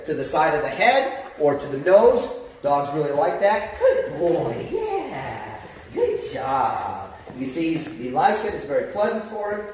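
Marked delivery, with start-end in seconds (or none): 3.8-7.1 praising the dog. Real, not acted.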